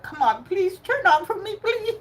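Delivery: high, whiny begging to a broken car; pleading with the car. Retold or imitated. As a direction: beg the car to start, pitch way up. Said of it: "I would always say that".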